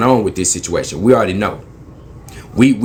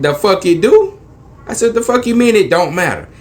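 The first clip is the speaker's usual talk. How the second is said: outraged; 9 semitones higher than usual. Retold or imitated. Driver snapping at officer that it does matter. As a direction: demand what he means, furious.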